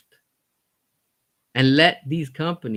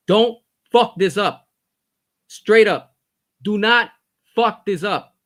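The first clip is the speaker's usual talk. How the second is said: slow, loud, higher shouted warning; furious warning; 6 semitones higher, 8 dB louder, slower than usual. Real, not acted. Fan shouting a warning at Netflix, slow and loud. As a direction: bark it out as a final threat.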